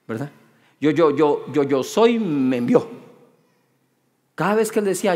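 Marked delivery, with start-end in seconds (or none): 0.8-2.9 lower, stumbling on every word; stuttering Moses. Retold or imitated. Moses stutters his answer to Pharaoh, lower, stammering. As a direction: stammer it out, stuck on each syllable. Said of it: "él era tartamudo".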